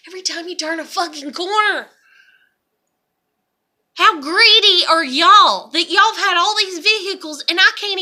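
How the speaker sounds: heated, exasperated venting; angry rant. Real, not acted. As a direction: rail at their greed in disbelief. Angry rant at relatives' greed.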